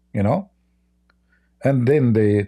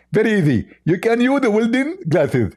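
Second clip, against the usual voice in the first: high, squeaky mock voice; a hammed-up high caricature voice; mocking Zakir Naik; 8 semitones higher than usual. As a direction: lampoon the opponent in a silly high pitch.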